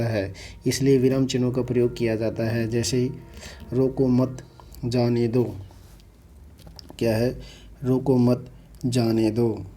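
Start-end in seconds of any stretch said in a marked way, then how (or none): 3.7-5.6 "रोको मत" together, pause, then "जाने दो"; pause after मत. Shown as a demonstration. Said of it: "थोड़ा रुकना होता हो". 7.8-9.7 "रोको मत" together, pause, then "जाने दो"; pause after मत. Shown as a demonstration. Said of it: "थोड़ा रुकना होता हो".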